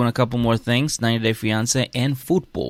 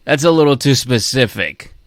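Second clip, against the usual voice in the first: louder, mock-suspicious objection; retold reaction; 6 dB louder than usual. Host reacting to Doris's voice, mock-suspicious objection. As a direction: call out the obvious giveaway. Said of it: "then it was Anthony's mom, and I was like".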